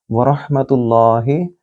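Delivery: रहमतुल्लाहि with a full, heavy र; slow recitation with a thick र. Shown as a demonstration. Teacher demonstrating the phrase, heavy r. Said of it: "मोटा पढ़ेंगे क्योंकि ये तफखीम है".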